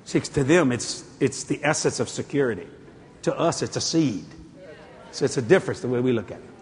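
ordinary delivery

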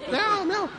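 playful cat noises; mock meow. Imitating animals at night.

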